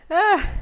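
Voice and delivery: high, loud gasp of effort; loud strained ah. Real, not acted. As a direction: cry out from the strain. Exhausted mid-workout.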